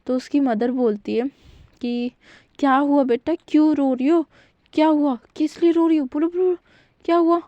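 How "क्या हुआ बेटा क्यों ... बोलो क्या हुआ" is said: higher, louder anxious questions; a mother pressing her crying daughter; worried mother. Acted. Mother worried on phone, higher and louder pleading. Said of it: "वो थोड़ा घबरा जाती है".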